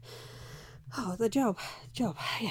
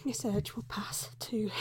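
ordinary delivery

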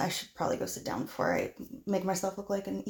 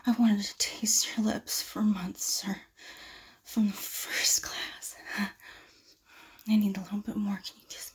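seductive whisper. Acted. Seducing the professor.

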